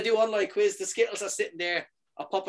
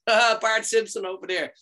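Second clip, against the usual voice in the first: chuckling through the nickname, higher and slower; laughing mockery; 6 semitones higher, 4 dB louder than usual. Real, not acted. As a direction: tease him while laughing. Mocking friend's yellow skin.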